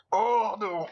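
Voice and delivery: ordinary delivery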